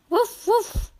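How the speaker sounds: high playful puppy woofs; puppy barking. Pretend. Narrator voicing Biscuit the puppy for kids, high woofs. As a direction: bark like a happy little puppy.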